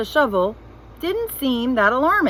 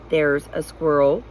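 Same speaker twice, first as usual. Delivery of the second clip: low, slow, word by word; "There's a squirrel" with every word set apart; slowly, pointing at each word; 6 semitones lower than usual. A narrator's reading. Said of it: "speaking more slowly, now as if she were pointing at each word".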